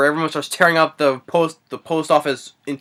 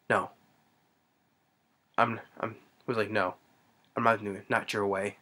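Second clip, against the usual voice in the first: quiet refusal; 6 semitones lower, 13 dB quieter than usual. Retold or imitated. Retelling man refusing the room.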